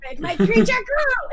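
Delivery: loud, high, drawn-out villainess cry; Rita Repulsa voice. Acted. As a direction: call it out slowly like a hammed-up TV villainess. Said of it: "asking Kat to do the sexy Rita".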